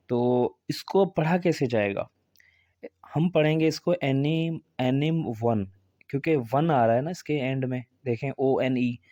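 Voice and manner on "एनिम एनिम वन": "एनिम वन" with ending like the word one; naive spelling reading. Shown as a demonstration. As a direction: misread as "एनिम वन", a-nim-wun. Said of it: "पर ऐसे नहीं पढ़ा जाएगा". ("एनिम वन", आख़िर में वन वाला ग़लत उच्चारण)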